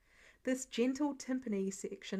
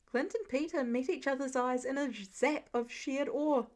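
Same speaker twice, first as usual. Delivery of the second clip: plain reading voice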